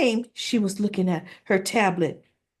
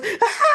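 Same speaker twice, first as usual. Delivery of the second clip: high-pitched acted scream of exasperation; mock frustrated scream; 4 dB louder than usual. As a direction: imitate a fed-up mother's shriek with a laugh in it. Mother angry, about to throw tablet.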